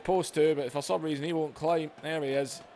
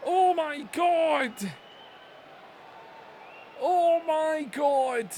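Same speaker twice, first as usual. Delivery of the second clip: slow disbelief; 8 semitones higher, much slower than usual. Real, not acted. Reacting to the fall.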